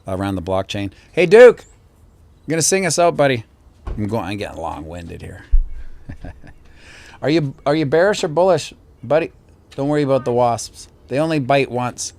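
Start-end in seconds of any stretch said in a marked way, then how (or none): 1.1-3.4 loud, high call across the room; cheerful shout to his son. Real, not acted. 7.2-12.1 higher, playful voice for his son; upbeat dad voice, higher and louder. Real, not acted. Chatting to son beside him.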